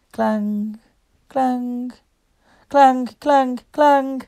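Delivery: building bell imitation; bell clangs. A narrator's reading. Bell ringing sounds.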